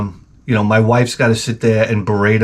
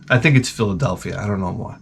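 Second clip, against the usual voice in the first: ordinary talk, nothing marked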